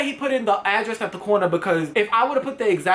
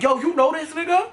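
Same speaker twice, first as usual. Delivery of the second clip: in-your-face challenge; 7 semitones higher than usual. Retold or imitated. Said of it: "in his face".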